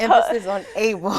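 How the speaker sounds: said through bright bursts of laughter; laughing. Real, not acted. Both laugh over the word able.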